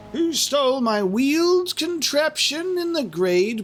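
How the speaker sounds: high-pitched theatrical riddle; theatrical line reading. Acted. Player reading an odd dialogue choice, theatrical. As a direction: pitch it high and draw out the end.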